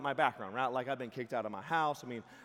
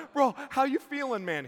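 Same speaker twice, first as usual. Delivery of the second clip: nervous high ask; 11 semitones higher, 12 dB louder than usual. Retold or imitated. Asking friend nervously.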